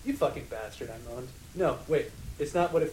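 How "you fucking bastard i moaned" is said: ordinary delivery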